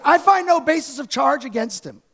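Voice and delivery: lightly voiced Pilate, hammed-up disbelief; incredulous Pilate. Mild mock disbelief as Pilate. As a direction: pastor mocks Pilate's empty verdict, lightly incredulous. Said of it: "he goes".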